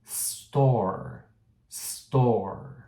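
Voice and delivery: "Store" with no vowel before the s, falling tune; clean s. Shown as a demonstration. Teacher modelling "Store" for students to copy. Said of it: "Outro exemplo".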